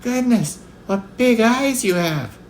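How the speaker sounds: put-on very high, drawn-out child's wonder; high-pitched wide-eyed amazement; astonished little girl. A narrator's reading. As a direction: marvel slowly as a small girl. Astonished little girl questions false Grandma.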